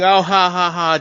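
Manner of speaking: spoken ha-ha laugh, put on; mock audience laughter. Moviegoer in the theater amused by a famous line, mock laughter. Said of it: "and you're laughing".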